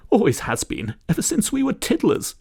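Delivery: put-on very high, fluttery old-lady voice; kindly old lady. Daisy offers Hugo tea and introduces herself, high fluttery old-lady voice. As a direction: offer the tea like a doting granny.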